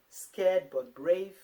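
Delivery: "Tired but strong" said very slowly and loudly; emphatic reading. Shown as a demonstration. Mom reading out the family motto, slow and emphatic.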